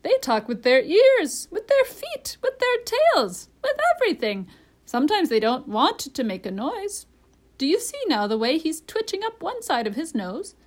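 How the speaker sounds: high, loud, emphatic parrot; piercing, insistent bird lecture. A narrator's reading. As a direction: hammer out each item in a high voice. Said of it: "in a high voice".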